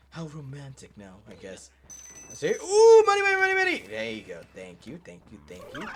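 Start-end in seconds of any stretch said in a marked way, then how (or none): 2.6-3.8 high, loud delighted chant of money; excited. Real, not acted. Player spotting money, high excited chant. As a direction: squeal over the loot.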